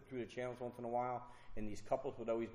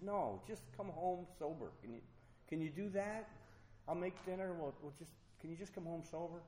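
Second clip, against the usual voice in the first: higher-pitched imitated plea; gentle pleading; 6 semitones higher than usual. Fiancee asking the drinker to come home sober, pleading. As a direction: imitate a partner asking a small favour, pitched up.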